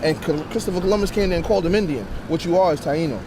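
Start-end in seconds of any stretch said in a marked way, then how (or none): none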